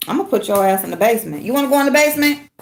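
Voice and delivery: fast mock threat. Real, not acted. Owner threatening her dog with the basement, fast.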